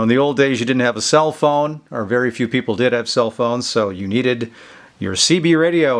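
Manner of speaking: ordinary delivery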